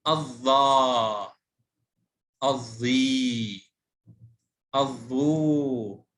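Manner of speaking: الظا، الظي، الظو with slow, drawn-out endings; long vowels. Shown as a demonstration. Teacher reviewing lengthened forms, endings of الظا، الظي، الظو held very long. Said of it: "مع مد الألف".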